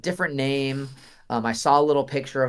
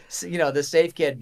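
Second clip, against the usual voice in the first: normal talking voice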